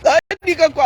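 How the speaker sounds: loud shrieking laugh into a shout. Real, not acted. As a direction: burst out laughing loudly. Rire criard puis cri. (éclat de rire criard)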